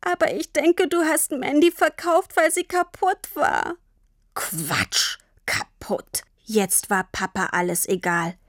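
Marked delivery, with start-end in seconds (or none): 0.0-3.8 put-on high, tearful child's voice; sobbing. A narrator's reading. Tini questions Papa about selling Mandy, sobbing. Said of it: "konnte vor Schluchzen kaum sprechen". 4.3-6.2 low, contemptuous mutter; scornful dismissal. A narrator's reading. Papa dismisses Tini's belief about Mandy, scornful dismissal.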